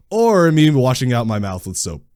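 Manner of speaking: ordinary delivery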